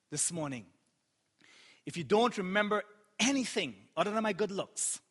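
normal talking voice

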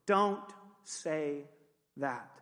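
measured, word by word, falling away; deliberate rebuke. Real, not acted. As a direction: pause before "that" and let it drop. Slow, deliberate repeat of the rebuke.

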